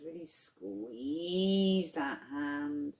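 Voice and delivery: slow, long-held "squeeze", gliding upward; effortful squeeze. Shown as a demonstration. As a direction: SQUEEZE drawn out long with a swooping rise in pitch. Urging listeners to tense the fist.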